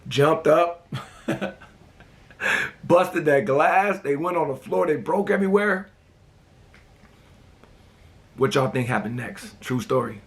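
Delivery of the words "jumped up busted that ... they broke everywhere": excited retelling. Retold or imitated. Retelling breaking the glass.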